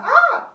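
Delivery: short, high, loud put-on scream; a mock shriek of alarm, not real fright; fake scream. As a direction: yelp out a deliberately fake shriek. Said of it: "it was like a fake scream".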